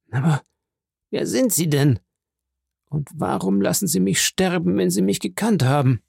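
dying Caderousse begging for answers; pleading dying man. A narrator's reading. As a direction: plead why he lets him die.

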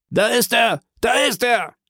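high-pitched call of discovery; alarmed shout. A narrator's reading. Pursuer outside spots the fugitive, calling out. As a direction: cry out that you've spotted him. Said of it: "rief eine Stimme auf dem Vorplatz".

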